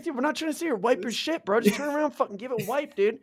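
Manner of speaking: high, pleading mock complaint to the roommate; exasperated mock plea. Roommates pleading with the big guy, exasperated and high. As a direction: beg him to just wipe it, half joking. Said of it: "it's like".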